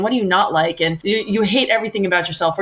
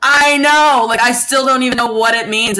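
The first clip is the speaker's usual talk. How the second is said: high, loud real exasperation; exasperated bafflement; 5 semitones higher, 5 dB louder than usual. Real, not acted. Agreeing with a friend over a baffling remark, exasperated. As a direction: burst out in baffled agreement, then ease slightly.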